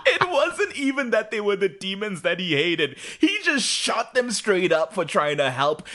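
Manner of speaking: high laughing rant; indignant through laughs; real amused outrage; laughing indignation. Real, not acted.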